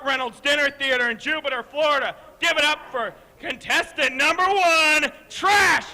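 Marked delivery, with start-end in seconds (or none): 5.3-5.8 slow shouted ring intro; announcer shout. Acted. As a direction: call the name out to the crowd.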